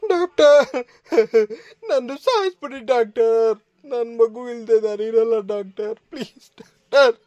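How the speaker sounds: high, loud grief-stricken begging; anguished wailing plea. Acted. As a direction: wail it out in despair, begging. Grieving father begs to die. (ದುಃಖದಿಂದ ಜೋರಾಗಿ ಅಳುತ್ತಾ ಬೇಡುವುದು)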